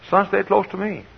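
high-pitched motherly call. Retold or imitated. Mother calls to wandering son.

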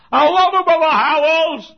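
very high, loud mock kid shout; imitated little-kid yell, stretched at the end; children's holler. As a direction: holler it like a child through a door. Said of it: "holler under my door".

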